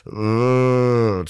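long, drawn-out queasy moan; re-enacted sick "ugh"; nauseous groan. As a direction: imitate a stomachache groan. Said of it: "that stomachache nausea feeling of".

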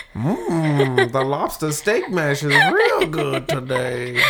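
slow mock old-timer savoring mash; old folks voice. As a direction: play a doddering old parent, slowly. Voicing old parents eating mash.

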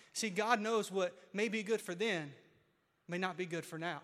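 ordinary talk

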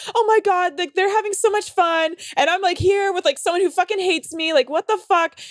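high-pitched mock-tearful complaint; hammed-up self-pitying wail; retold crying harder. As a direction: whine it high, imitating your own meltdown. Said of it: "I start crying even harder".

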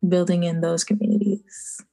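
ordinary talk

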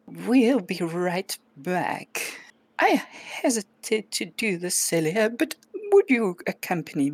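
a polite witch asking Celia along; witch speaking. Acted. GM voices witch to kittens.

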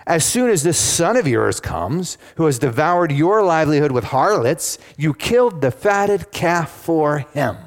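high-pitched, emphatic accusation; aggrieved older brother's protest; indignant older son. Acted. Older son complains to his father.

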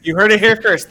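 playful breaking-news delivery; mock news announcer. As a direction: lightly announce it as a scoop.

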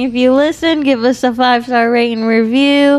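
podcast outro. Acted. Hosts thanking listeners at the end of the episode, in a cheery sign-off voice. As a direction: wrap up the show with a cheery announcer lift.

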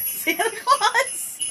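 high, slow, laughing question; laughing disbelief. Real, not acted. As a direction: repeat it in amused disbelief.

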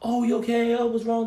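high, put-on fussing; mock concern. Friend mimicking the concern criers expect, mock-solicitous. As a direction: act out token sympathy.

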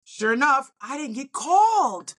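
ordinary delivery

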